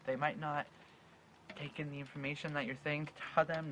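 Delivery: usual speaking voice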